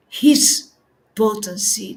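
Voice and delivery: ordinary talk, nothing marked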